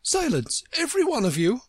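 loud, high order; commanding cry. A narrator's reading. Tiger Lily silencing the shouting daisies, commanding cry. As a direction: cry out high to quell the chatter. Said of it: "cried the Tiger Lily".

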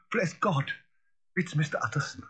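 high-pitched cry of relief. A narrator's reading. Cook sees Mister Utterson arrive, crying out. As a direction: exclaim with pitch thrown high. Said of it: "the cook cried out".

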